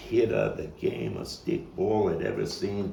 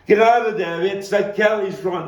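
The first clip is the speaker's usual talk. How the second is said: yelling warning; 11 semitones higher, 10 dB louder than usual. Acted. Friend yelling at Sammy as the car comes, desperate scream. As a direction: scream at him to run. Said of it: "I started yelling at Sammy".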